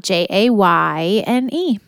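J A Y N E, each letter said separately; spelling it out. Shown as a demonstration. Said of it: "My name is spelled".

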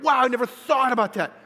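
enthralled. Retold or imitated.

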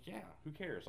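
soft, offhand shrug of a line; nonchalant Esau. Acted. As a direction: toss it off quietly, as if it hardly matters. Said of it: "nonchalant about".

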